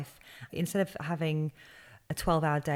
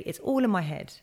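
ordinary delivery

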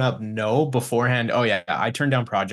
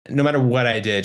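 normal talking voice

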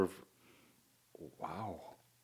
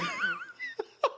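high squealing laugh; 5 dB quieter than usual. Real, not acted.

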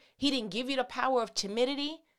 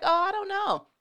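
mock unsure Israelites; 7 semitones higher, 3 dB louder than usual. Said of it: "the Israelites was like".

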